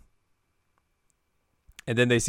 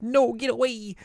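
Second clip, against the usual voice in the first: high frightened cry; scared kid shriek; 13 semitones higher than usual. Retold or imitated. Kid backs away from the murderer, high scared shriek. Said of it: "And she's like".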